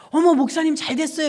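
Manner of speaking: high, loud delighted exclamation. Retold or imitated. Fellow pastor delightedly answering the phone call. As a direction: gush with sudden good news.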